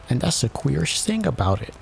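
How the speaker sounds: high-pitched royal attendant. A narrator's reading. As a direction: pipe up high to the king.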